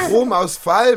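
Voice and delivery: laughing correction, slowly. Acted. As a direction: laugh as you correct him.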